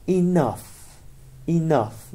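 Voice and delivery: ENOUGH said clearly, ending in an F sound; careful pronunciation. Shown as a demonstration. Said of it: "دقت کنید نحوه تلفظشون رو". (تلفظ واضح ENOUGH با صدای «ف» در آخر)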